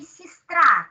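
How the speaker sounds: сестра with -ра stressed; stress on the last syllable. Shown as a demonstration. Teacher showing the stressed vowel to the class. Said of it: "you say".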